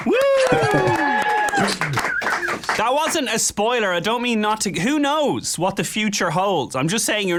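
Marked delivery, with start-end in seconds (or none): 0.2-2.7 high squealing giggle; shrill giggle at his own tease; squealing laugh. Real, not acted.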